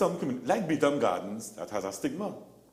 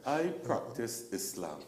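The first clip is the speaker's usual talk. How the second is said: laughing through it; 5 semitones higher, 7 dB louder than usual. Real, not acted. Laughing while telling landlord story.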